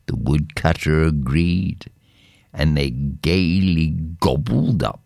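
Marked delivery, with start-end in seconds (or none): none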